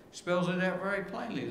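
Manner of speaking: ordinary delivery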